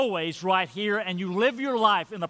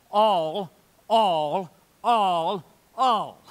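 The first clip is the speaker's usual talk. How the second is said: each "All" hit louder and set apart; emphatic all; 4 dB louder, much slower than usual. Shown as a demonstration. Preacher drives home Paul's repeated word, emphatic. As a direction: hammer "all" four times, one by one. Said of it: "He's emphatic".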